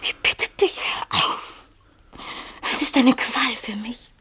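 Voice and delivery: slow pained whisper; anguished whisper. Acted. Therese begging her lover to stop, in a pained whisper.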